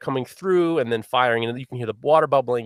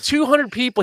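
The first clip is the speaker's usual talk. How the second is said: incredulous; 10 semitones higher, 5 dB louder than usual. Real, not acted. Incredulous repeat of number.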